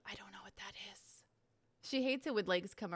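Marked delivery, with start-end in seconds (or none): 0.1-1.2 hushed unvoiced whisper. Real, not acted. Streamer puzzling over the chat request, whispering. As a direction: whisper it under your breath. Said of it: "whispers to herself".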